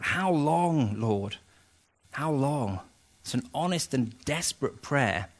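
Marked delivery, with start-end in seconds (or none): 0.0-2.8 pleading quote, drawn out but restrained; mild retold cry-out. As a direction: cry out to God with restraint, not volume. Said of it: "he cries out".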